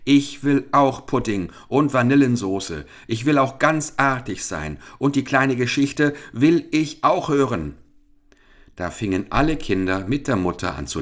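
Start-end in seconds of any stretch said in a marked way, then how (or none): 0.0-7.8 put-on mild childish outcry; calling child. A narrator's reading. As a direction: call out eagerly like a greedy child.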